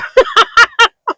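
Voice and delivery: real high-pitched laugh; high giggle. Real, not acted. Laughing at her own excuse for not recording, high giggle. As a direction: burst into a high, rippling laugh.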